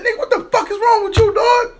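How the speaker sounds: loud, very high exasperated demand; retold angry yell. Man retelling how he confronted his drunk friend, exasperated yell. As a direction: cuss him out at the top of your range.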